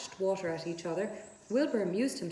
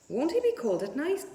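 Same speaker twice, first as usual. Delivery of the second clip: lightly voiced little girl, worried and higher; a girl's anxious question, a touch higher; young Fern asking; 7 semitones higher, 5 dB louder than usual. A narrator's reading. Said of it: "asked Fern".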